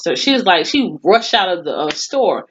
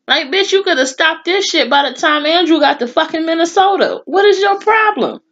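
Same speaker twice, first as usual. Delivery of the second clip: outraged rant; 9 semitones higher than usual. Acted. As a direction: lay into him like he blew the whole case. Ranting at the FBI agent.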